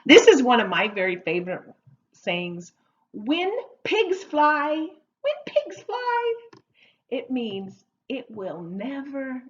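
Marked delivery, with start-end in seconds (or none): 3.1-4.7 slow, raised, emphatic delivery; slow model phrase. Shown as a demonstration. Teacher introduces idiom, first take. 5.2-6.3 very high, slow silly voice; high-pitched repeat. Shown as a demonstration. Teacher repeats idiom playfully high.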